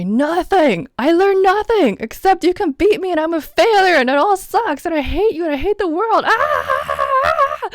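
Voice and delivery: mock tantrum, high loud yelling. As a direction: throw a hammed-up fit, building into a scream. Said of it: "we throw the biggest tantrum".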